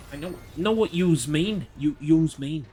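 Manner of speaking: fumbled Scouse impression, "yous" stressed; attempted Scouse. Shown as a demonstration. Trying a Scouse accent for the chat. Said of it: "I can't do Liverpudlian".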